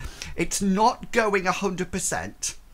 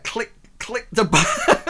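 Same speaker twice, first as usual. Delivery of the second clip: excited; 6 semitones higher, 6 dB louder than usual. Real, not acted. Excited game start call.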